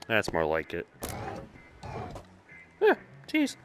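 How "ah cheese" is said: squeaky delight. Real, not acted. Reacts to cheese on screen.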